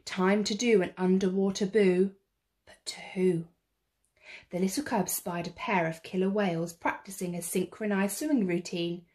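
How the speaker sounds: usual speaking voice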